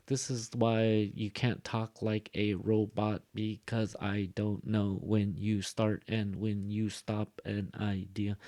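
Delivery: even, word-by-word monotone; robotic delivery without thought groups; robot speaking. Shown as a demonstration. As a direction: give every word equal weight like a robot. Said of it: "like a robot speaking".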